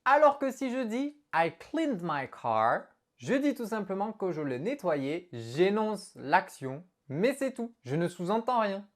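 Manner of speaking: ordinary talk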